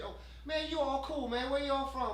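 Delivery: high, loud, friendly street voice; excited approval. Acted. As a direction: act out someone won over and delighted. Acting out won-over homeless people.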